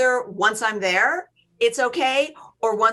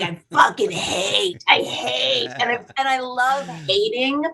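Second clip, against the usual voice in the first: gleeful mock hatred; 3 semitones higher than usual. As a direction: stretch it out, relishing the negativity. Relishing being negative, mock hating.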